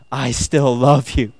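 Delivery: slow, softened declaration after a tirade; acted forgiving affection; tender turnaround. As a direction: drop down and reassure lovingly. Tender turn after mock insults.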